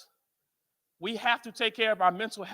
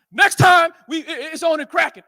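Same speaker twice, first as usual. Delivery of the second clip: louder, punchy threat on the second next time; mock shouted threat; 2 semitones higher, 7 dB louder than usual. Brothers warning the peacekeepers they'll be back, shouting. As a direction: bark the warning like a street brother.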